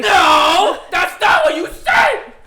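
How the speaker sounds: very high, loud screech; high yelled protest. Pretend. Cutting in to dispute what was said, high screamed protest. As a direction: shout it slowly in a shrieking protest.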